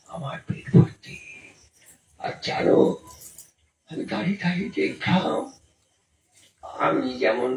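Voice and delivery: low breathy whisper of a secret; hushed confession. Retold or imitated. Preacher confiding to the crowd in a hush. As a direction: let them in on a secret, hushed.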